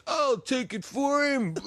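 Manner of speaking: slow, high mock shout; nephew grabbing the shot. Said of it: "comes up and goes".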